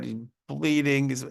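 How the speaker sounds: ordinary talk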